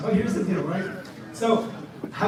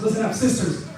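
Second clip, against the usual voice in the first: ordinary talk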